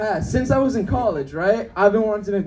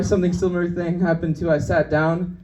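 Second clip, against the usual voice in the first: ordinary talk, nothing marked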